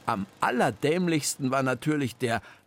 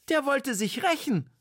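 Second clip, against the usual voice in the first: much higher, eager realisation; called out; 12 semitones higher than usual. Acted. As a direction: call out the realisation. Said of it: "rief Frau Hach".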